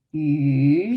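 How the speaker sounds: YU drawn out, low and dipping; third tone. Shown as a demonstration. Teacher naming each tone, long dipping YU. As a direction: dip slowly through a long YU. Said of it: "third tone".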